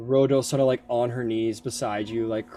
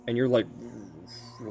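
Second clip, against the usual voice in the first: usual speaking voice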